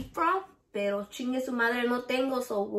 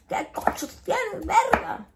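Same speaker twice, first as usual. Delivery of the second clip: Texas cousin voice; 10 semitones higher than usual. Retold or imitated. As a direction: do the cousin's slangy Texas Spanish call-out. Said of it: "That's how my cousins talk".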